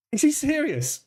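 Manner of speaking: stunned by what he just read; high-pitched shocked aside; disbelief. Real, not acted. Disbelief at Trump post.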